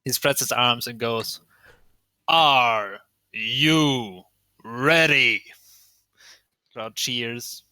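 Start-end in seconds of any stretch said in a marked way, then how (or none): 2.3-5.4 drawn-out ring announcer's call; booming, stretched-out arena hype. Acted. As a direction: work the crowd, stretching each word. Ring announcer hyping a crowd.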